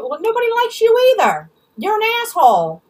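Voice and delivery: high, loud angry comeback; heated, high-pitched insult; indignant retort. Real, not acted. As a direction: hurl it back at her, pitched high. Snapping back at absent woman.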